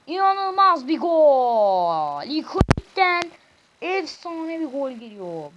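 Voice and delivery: drawn-out loud goal call; screaming football commentator. Acted. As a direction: belt it like a stadium announcer. Calling the goal again.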